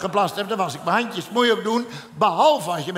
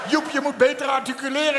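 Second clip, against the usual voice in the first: wife calling out; 6 semitones higher than usual. Retold or imitated. Vrouw roept door de deur.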